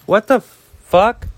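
startled outburst. Real, not acted. Startled by a noise at home.

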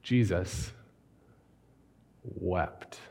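"Jesus" then a long pause, "wept" soft and slow; solemn slow reading. Shown as a demonstration. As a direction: let the two words hang in reverent hush. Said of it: "a whole sermon on two words".

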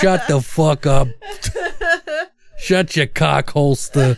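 a hint of a movie thug, laughing; half-laughing gangster line; mock tough guy. Quoting the movie's character, a light mock thug. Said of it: "Sorry, it's the character".

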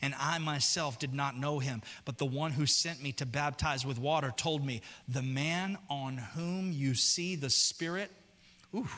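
slow, hushed reading of the verse. Acted. Preacher reading John to the church, slow and hushed. Reads scripture softly.

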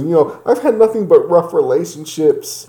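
high, whiny, self-pitying voice; whiny crying woman. Retold or imitated. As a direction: mimic a weepy excuse-maker. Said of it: "she starts going into her past and says".

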